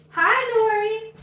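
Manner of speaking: high, slow sweet hello; sing-song greeting. Real, not acted. Owner greets the dog in a sweet high voice.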